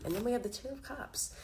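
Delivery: usual speaking voice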